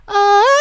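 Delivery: very high, loud excited shriek; loud thrilled squeal in the cinema. Retold or imitated. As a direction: squeal as the film is about to start. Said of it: "I was like".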